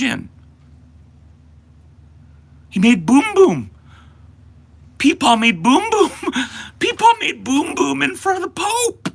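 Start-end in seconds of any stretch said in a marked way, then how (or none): 2.7-9.1 put-on high, loud singsong baby talk; baby-talk mockery. Streamer mocking old peepaw in loud, high baby talk. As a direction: taunt it like talking to a toddler.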